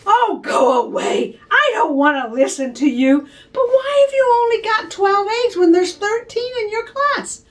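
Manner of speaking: loud, tearful whining cry; upset girl. Acted. Little girl crying at her teasing brother, loud whine. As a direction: wail it out like a hurt child. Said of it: "made Brenda look really upset".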